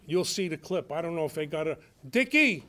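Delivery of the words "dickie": loud, high call across the studio. Real, not acted. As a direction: shout the name to someone offstage, laughing. Calling offstage to the clip guy.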